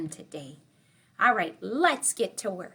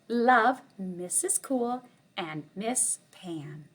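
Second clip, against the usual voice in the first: ordinary delivery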